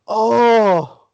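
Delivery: loud, high, drawn-out "oh"; backstage crowd gasp. Retold or imitated. Wrestlers behind the curtain reacting to a slap, loud drawn-out oh. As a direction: let out a big shocked "ohhh". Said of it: "everyone back behind the curtain just go".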